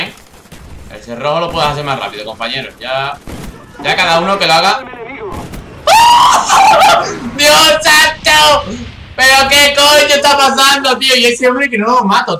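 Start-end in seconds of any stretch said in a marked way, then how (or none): 4.9-7.3 very high, loud burst of laughter; high shrieking laugh. Real, not acted. Gamer reacting to a shock in play, shrieking laugh. 7.4-12.4 high, loud, incredulous outburst; exasperated shout. Real, not acted. Gamer who cannot kill his target, exasperated shouting.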